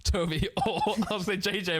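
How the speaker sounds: laughter breaking into the words; laughing. Real, not acted. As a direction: list the names while cracking up. Cracks up while listing names.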